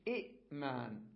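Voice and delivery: إئمان split as short i, catch, maan; silent second hamza. Shown as a demonstration. Teacher isolating both hamzas, broken after i. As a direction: stop the breath after the i in إئمان. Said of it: "والهمزة الثانية ساكنة".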